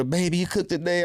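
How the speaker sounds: hammed-up sweet lady voice; imitating a woman. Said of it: "he'll turn into a woman".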